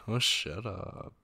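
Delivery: laughing, playful shut up; bashful laugh through the words; giggly mock protest. Teased about saying fiance.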